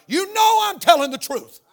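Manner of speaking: high, loud preaching cry; emphatic shout. Real, not acted. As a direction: shout it out to the whole congregation. Preacher drives point home to congregation.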